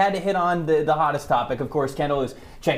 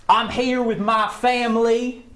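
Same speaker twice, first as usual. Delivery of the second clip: Brian Kelly's fake Southern accent; 2 semitones higher than usual. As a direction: put on a bad Southern accent, stretching key words. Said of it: "I just had to get my Brian Kelly impression".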